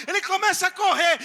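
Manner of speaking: normal talking voice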